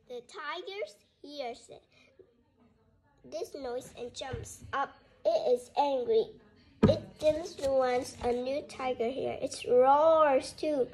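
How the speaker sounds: plain reading voice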